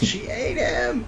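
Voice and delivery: high, slow horrified shout; mock terror, drawn out; panicked cry. Usopp reacting to Hera eating Zeus, with a high, slow panicked cry. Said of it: "Usopp is like".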